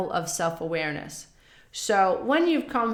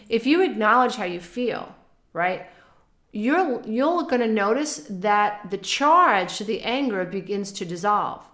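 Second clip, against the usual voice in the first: normal talking voice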